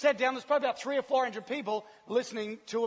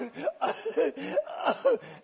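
high, halting imitation sobs; mock sobbing gasps; 8 semitones higher than usual. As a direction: gasp through pretend crying. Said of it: "And I'm going".

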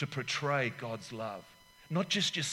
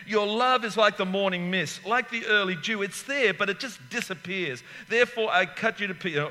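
raised, loud preaching voice; 5 semitones higher, 5 dB louder than usual. Acted. Preacher pressing the congregation, loud and raised. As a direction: preach it out to the church. Sermon delivered with force.

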